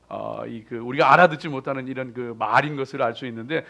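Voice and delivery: normal talking voice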